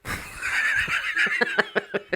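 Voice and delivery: airy high-pitched laugh. Real, not acted. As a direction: burst out laughing. Laughing at own joke.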